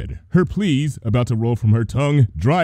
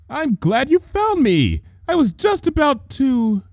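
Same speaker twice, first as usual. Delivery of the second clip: high, nervous false welcome; nervous Trixie. Acted. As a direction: fake brightness hiding panic. Trixie nervously greets Luna.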